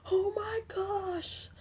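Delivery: high, drawn-out gasp of dismay; hammed-up horror, softly squeaked out; mock shock. Mock reaction to powder mess on opening.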